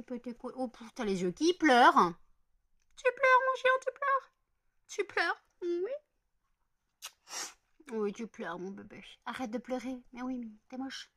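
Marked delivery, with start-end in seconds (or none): 2.9-5.9 very high pet-talk voice. Real, not acted. Talking to her crying pet, high baby talk. 7.9-11.1 softer, tender murmur; soft pet talk. Real, not acted. Soothing her pet in a soft, tender voice.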